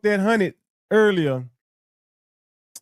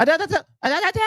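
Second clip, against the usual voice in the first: mock catchphrase; 8 semitones higher, much faster than usual. Repeating the catchphrase mockingly.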